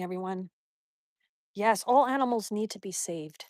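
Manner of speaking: usual speaking voice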